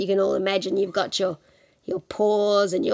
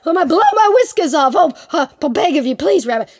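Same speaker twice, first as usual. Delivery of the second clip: blustery, hammed-up pleading; anxious tiger; 5 semitones higher, 8 dB louder than usual. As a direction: fret louder, then beg the rabbit. Plays Tiger begging.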